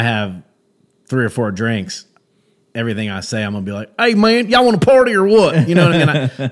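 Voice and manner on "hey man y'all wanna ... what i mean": dumb Southern voice. Acted. Comic showing his persona after a few drinks, dumb Southern accent. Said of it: "dumb Southern voice".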